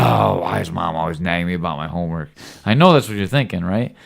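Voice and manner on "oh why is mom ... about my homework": a hint of a whiny kid; lightly mock the sulky son. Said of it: "And you're like".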